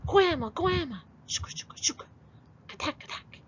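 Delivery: scary noises. Acted. Boogeyman gang bursting in.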